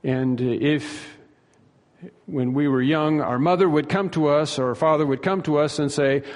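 ordinary talk

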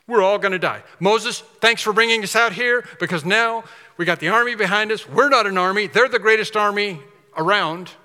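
lightly acted crowd protest; mock panicked complaint. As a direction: complain in alarm, as the trapped crowd. Panicked people blaming Moses.

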